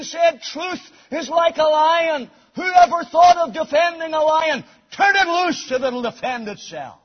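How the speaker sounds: high, ringing pulpit proclamation; full-force preaching. Real, not acted. Preacher declaring Scripture is God-breathed, full-force preaching. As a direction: preach it out with weight on the key words.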